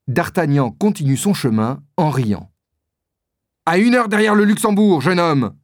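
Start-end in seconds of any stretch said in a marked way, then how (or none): 3.7-5.6 défi énervé, plus aigu et plus fort; très énervé. A narrator's reading. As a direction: lancer le rendez-vous avec irritation. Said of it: "dit Porthos très énervé". (Porthos fixe le duel, agacé et fort)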